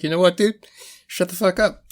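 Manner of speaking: high-pitched imitation of telling yourself off; squeaky-high mock telling-off; mock self-scolding. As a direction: jump way up high for a comic rebuke.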